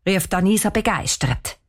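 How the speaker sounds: plain reading voice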